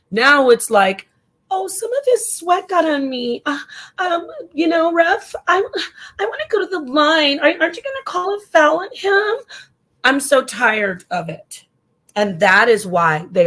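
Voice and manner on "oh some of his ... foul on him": high, whimpering mock complaint to the ref; whiny flopper. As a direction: whine like a player begging for a foul, near tears. Mocking whiny players begging fouls.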